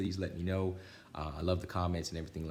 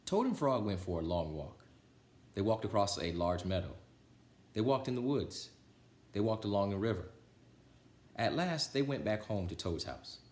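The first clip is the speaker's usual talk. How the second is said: put-on lively, louder read-aloud voice for children; storybook reading; 4 semitones higher, 5 dB louder than usual. A narrator's reading. Reader telling Frog and Toad to children, lively storybook voice. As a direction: read it like bedtime story time for kids.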